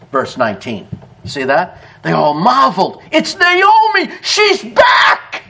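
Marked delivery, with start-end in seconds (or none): 3.1-5.4 high, excited shout of recognition; excited cry. Acted. Townspeople greeting Naomi on her return, high and excited. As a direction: cry out in delighted surprise.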